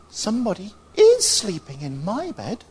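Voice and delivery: high little bear squeak; small squeaky voice. Acted. Little bear looks at his bed, squeaky voice. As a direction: squeak it out, leaning on "is". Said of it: "squeaky little voice".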